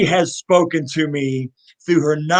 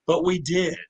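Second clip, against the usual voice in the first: ordinary talk, nothing marked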